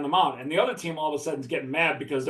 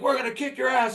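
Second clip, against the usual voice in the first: mock threat; 8 semitones higher than usual. Rival team threatening at the bus.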